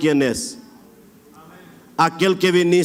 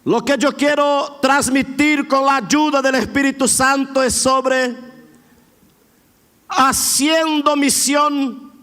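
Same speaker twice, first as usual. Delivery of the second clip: rising, emphatic sermon delivery, higher and stretched; preaching; 5 semitones higher than usual. Acted. Pastor urging the congregation to praise now, fervent preaching. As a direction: build the appeal, pressing each phrase. Preaching builds to full force.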